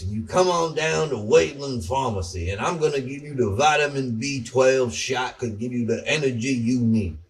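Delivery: a Southern pharmacy owner selling shots; imitating a Southern pharmacist. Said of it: "he talks like, like this".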